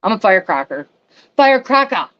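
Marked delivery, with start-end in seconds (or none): 1.4-2.1 put-on playful, high-pitched New York accent, a bit louder. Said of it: "There's my New York accent".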